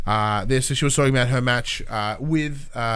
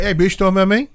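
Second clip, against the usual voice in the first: louder, higher sassy callout; mock challenge; 6 semitones higher, 5 dB louder, faster than usual. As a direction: get in her face. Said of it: "being like".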